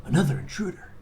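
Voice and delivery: hushed, whispered Snake voice; Snake impression. Doing Snake on request for a friend, hushed and whispered. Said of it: "Do an impression of him again".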